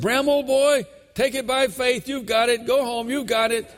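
high, loud, hearty church-officer send-off; brisk dismissive officer. Retold or imitated. Officer sending Bram home from the altar, hearty and dismissive. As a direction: imitate a man breezily hurrying a seeker off. Acts officer hurrying convert away.

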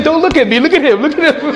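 gently pointing away from himself; Holy Spirit urging. Retold or imitated. Holy Spirit voiced, lively.

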